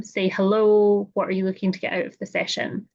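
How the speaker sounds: usual speaking voice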